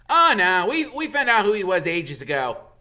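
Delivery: loud, proud schoolboy brag; impressively. A narrator's reading. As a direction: show off what you know. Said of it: "said Ron impressively".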